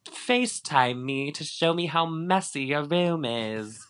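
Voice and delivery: high, slow put-on voice; Lisa Vanderpump. Host mimicking Lisa asking Max to show his room, high drawn-out voice. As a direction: make the request high and drawn out. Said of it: "she's like".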